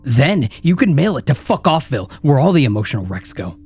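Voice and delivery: from a sneering taunt to a high screaming rant; building to a scream. Acted. Inner critic rant builds to screaming.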